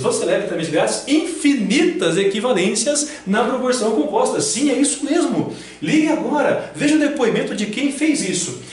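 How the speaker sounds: loud, high TV-salesman pitch; infomercial. Pretend. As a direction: sell it like a late-night ad. Paródia de infomercial. (locutor de comercial de TV exagerado)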